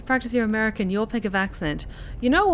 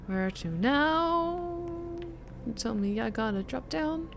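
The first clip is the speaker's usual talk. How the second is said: put-on softer, practiced American accent; 3 semitones lower, 8 dB quieter than usual. Gamer puzzling out a broken elevator, soft American accent. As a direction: think aloud in an American accent. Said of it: "talking like this".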